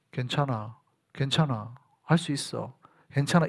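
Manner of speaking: low, soft self-soothing; quiet, low-pitched comfort; calm reassurance. Acted. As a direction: murmur it steadily, settling the heart.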